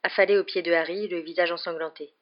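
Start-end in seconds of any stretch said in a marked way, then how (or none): none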